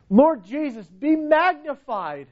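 high, drawn-out cry of praise; exalting praise. Acted. Preacher voicing Stephen's praise to Jesus, exalting cry. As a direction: lift the voice high in worship.

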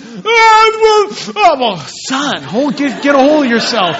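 high wailing mock cry of a crying kid; imitating a sobbing boy. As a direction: blubber it out like a weeping little boy. Son weeping after losing soccer game.

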